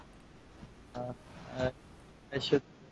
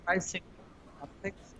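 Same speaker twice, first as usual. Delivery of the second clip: volume dropping to nothing mid-phrase; a broken call line swallowing the words; audio cutting out; 5 semitones higher, 15 dB quieter than usual. Real, not acted. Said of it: "Your audio is cutting".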